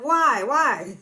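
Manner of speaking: loud, high hammed-up "why"; a fake anguished outcry; mock yell. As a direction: shout it at her, half playing. Acted outburst.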